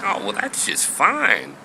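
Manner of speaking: high, lilting Southern voice; high-pitched Southern accent; Southern lady. Acted. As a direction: play a pleased Southern lady. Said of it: "She's from the South".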